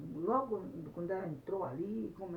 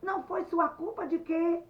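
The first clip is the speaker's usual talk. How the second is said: high, loud indignant challenge; angry shouting; 11 semitones higher, 8 dB louder than usual. Acted. Anete challenges Luiz's excuse, shouting. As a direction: throw it back at him in a shout. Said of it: "E ela estava gritando".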